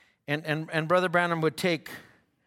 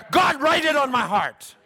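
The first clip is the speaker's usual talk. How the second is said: higher, louder prayer flung upward; fervent prayer cry; 9 semitones higher, 6 dB louder than usual. Real, not acted. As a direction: beg God out loud. Prayerful cry within preaching.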